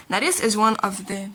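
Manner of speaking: usual speaking voice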